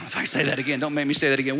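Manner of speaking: low mock-reluctant groan of a voice; mock reluctance. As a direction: play worn out and unwilling. Voices congregation reluctant to repeat.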